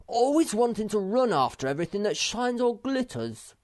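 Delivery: loud, high-pitched indignant rant; furious. A narrator's reading. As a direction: rail crossly about a friend. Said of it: "said Moomin Troll furiously".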